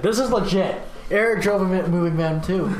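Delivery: high, loud and thrilled; excited. Real, not acted. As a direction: gush over the van with glee. Excited about the van.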